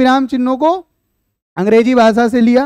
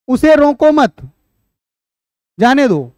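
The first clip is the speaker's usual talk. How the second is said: उसे रोको मत held together and raised, long pause, then जाने दो; pause after मत. Shown as a demonstration. Teacher demonstrates the comma after मत, pause after मत. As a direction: read it as don't stop him: break after मत, then जाने दो. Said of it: "कि उसको मत रोको".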